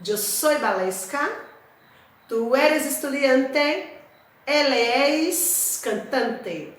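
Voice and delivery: slow, deliberate teacher's Spanish; slow Spanish model sentences. Shown as a demonstration. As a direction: spell each sentence out for learners. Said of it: "Agora vamos construir frases com esses verbos". (frases-modelo em espanhol, bem devagar)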